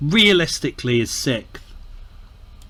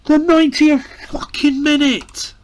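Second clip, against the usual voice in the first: much higher, louder cry of despair; anguished shout; 5 dB louder than usual. Real, not acted. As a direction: wail at the late goal. Conceding in the last minute.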